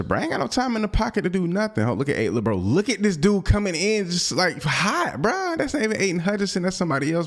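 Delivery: high, fast panicked complaining; frustrated rant. Real, not acted. Gamer complaining about pressure, high frantic rant. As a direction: shriek about the pass rush, high and quick.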